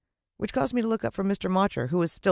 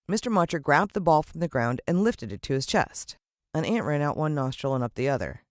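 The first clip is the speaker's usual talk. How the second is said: ordinary delivery, nothing marked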